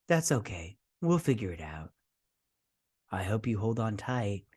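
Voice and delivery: quiet menacing croon; creepy doctor. Retold or imitated. Doctor warning Crosshair before torture, soft and menacing. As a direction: promise pain gently like a creepy doctor. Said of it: "He's like".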